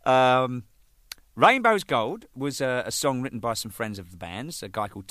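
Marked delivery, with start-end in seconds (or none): none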